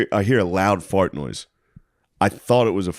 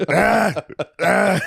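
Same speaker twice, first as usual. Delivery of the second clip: put-on drunk yell; 10 semitones higher than usual. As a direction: bellow like a wasted Indiana local. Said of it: "It's just like".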